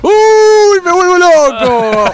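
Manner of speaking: high, loud, drawn-out whoop; excited outburst. Real, not acted. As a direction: cry out in overjoyed amazement, stretching the words. Reacting to surprise studio guest arriving. (grito agudo de euforia)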